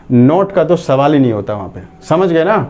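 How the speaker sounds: usual speaking voice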